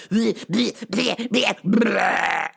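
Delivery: mock vomiting noises, repeated; imitated retching. Imitating pets vomiting at night, mock retching. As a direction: retch rhythmically, building to a long blurt. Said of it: "the violent vomiting in the middle of the night".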